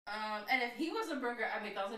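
ordinary talk, nothing marked

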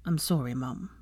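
subdued, weak sorry; apologetic. A narrator's reading. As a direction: apologise quietly from a sickbed. Chastened weak son apologising.

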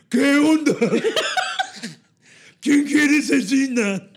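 hammed-up Morelos accent. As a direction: overdo a Cuernavaca accent. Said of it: "inventar el acento morelense".

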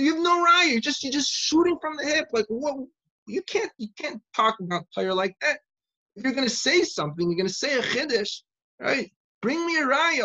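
high, heated tirade, loud at the start; screamed scolding. Retold or imitated. Rabbi demanding proof from a student, screaming. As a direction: berate him and demand proof. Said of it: "he screamed at the top of his lungs".

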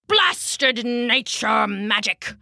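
slow, spat-out curse in a character voice; spitting anger. A narrator's reading. Thwarted knight curses the druid's spell, spitting anger. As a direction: spit the words out slowly. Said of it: "Rancier spat".

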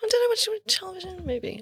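tiny high-pitched mock wondering; mock self-questioning. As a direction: play at doubting yourself in a small, high voice.

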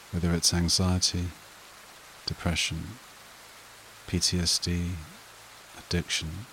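soothing breathing-exercise delivery, spaced out and unrushed; guided meditation. Acted. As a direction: guide them gently into calm, phrase by unhurried phrase.

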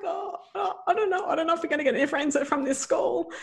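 high, fast, anxious hedging; mother doubting her daughter will find friends, high and quick; doubtful mum. Retold or imitated. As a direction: warn her gently but nervously. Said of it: "And I'm like".

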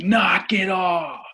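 high, drawn-out mock yell; retold parental yell. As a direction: holler it like a fed-up parent. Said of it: "and I yelled it".

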